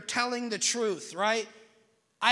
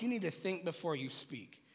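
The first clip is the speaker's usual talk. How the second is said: mom's advice; 5 semitones lower, 7 dB quieter than usual. Retold or imitated. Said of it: "My mom used to always tell me".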